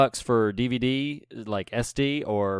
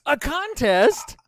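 surprised shout; slower than usual. Acted. Cohost plays along, amazed.

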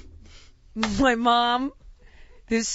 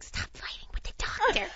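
urgent hushed whisper; hissed under the breath; mom's scolding whisper; 10 semitones higher, 6 dB quieter than usual. Retold or imitated. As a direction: whisper a reprimand like an embarrassed mother. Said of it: "my mom's like".

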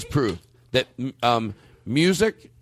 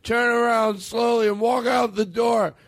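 put-on high, slow drunken tirade; drunk angry lament; 11 semitones higher, slower than usual. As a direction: lay it on like a bitter drunk. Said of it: "I'm gonna quote this right here".

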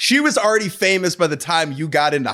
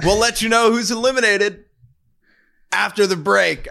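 Ryan Seacrest tease; 5 semitones higher than usual. Retold or imitated. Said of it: "remember, like, Ryan Seacrest in American Idol".

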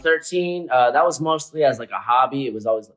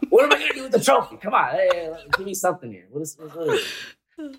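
higher-pitched, fast, comic complaint; mock exasperated protest; 6 semitones higher, faster than usual. Dancer protesting a trophy prize, in mock exasperation. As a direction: rattle off a hammed-up demand for something better.

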